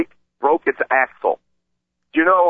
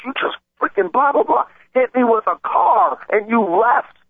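much higher pitched outraged yell at the driver; retold angry yelling; 12 semitones higher than usual. Cyclist replaying shouting at the driver who hit him, high outraged yell. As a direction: hurl it at the driver as he pulls away.

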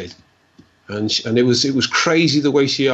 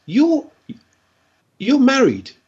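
"you're married?" rising much higher and louder; surprised; 13 semitones higher, 4 dB louder, slower than usual. Retold or imitated. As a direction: realise something mid-sentence, astonished. Seer surprised by vision.

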